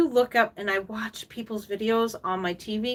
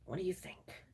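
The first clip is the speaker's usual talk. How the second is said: breathy whispered question; a quick hushed aside; 16 dB quieter than usual. Real, not acted. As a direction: drop to a secret whisper.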